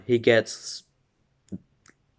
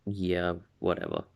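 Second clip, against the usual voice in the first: dismissive; 3 semitones lower, 7 dB quieter than usual. Retold or imitated. Deku brushing her off.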